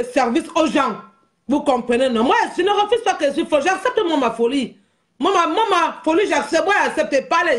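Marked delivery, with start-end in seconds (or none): 2.2-7.6 defiant boast. Real, not acted. Proud declaration, louder and higher.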